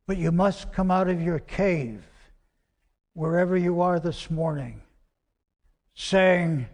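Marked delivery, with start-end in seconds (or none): none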